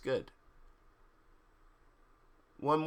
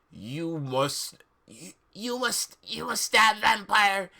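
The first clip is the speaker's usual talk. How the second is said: hammed-up command, rising to a push; mock villain insisting; 5 semitones higher, slower than usual. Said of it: "he said to me".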